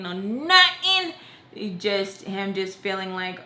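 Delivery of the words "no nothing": "no nothing" slow and high; emphatic finish. Retold or imitated. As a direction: stretch "no nothing" out, pitched way up. Mock-listing what did not happen.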